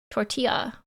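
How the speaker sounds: "Tortilla" with the double L said as Y; corrected pronunciation. Shown as a demonstration. Streamer practising double L as Y, corrected pronunciation of "Tortilla". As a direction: say "Tortilla" as tor-tee-ya. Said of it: "Double L equals Y".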